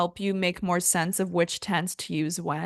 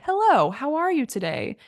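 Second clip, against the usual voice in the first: polite formal greeting; 6 semitones higher than usual. Shown as a demonstration. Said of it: "You would wanna say".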